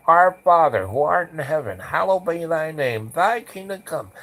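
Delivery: high-pitched, urgent recited prayer; imitating a desperate loud prayer. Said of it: "as loud as I can".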